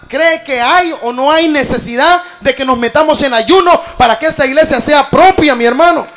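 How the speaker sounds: loud, driving sermon delivery; urgent exhortation projected to the congregation; full-force preaching. Real, not acted. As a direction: preach it loud, hammering the key words.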